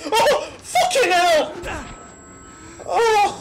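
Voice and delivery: high scream into nervous laughter; being chased. Real, not acted. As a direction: scream then laugh with relief. Scare then laughing relief.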